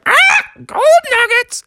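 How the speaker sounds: high shrill eagle cry; eagle squawk. Acted. Eagle snatching the nugget from Bubby, squawking. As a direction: screech it like a diving eagle.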